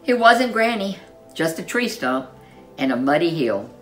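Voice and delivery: ordinary delivery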